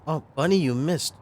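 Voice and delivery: mocking. Mocking the unit.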